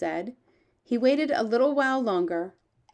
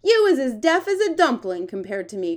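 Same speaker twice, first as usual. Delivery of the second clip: giant's raised, louder exclamation; higher, emphatic character voice; crying out; 5 semitones higher, 7 dB louder than usual. Acted. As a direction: cry it out as an astonished storybook giant. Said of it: "cried the B F G".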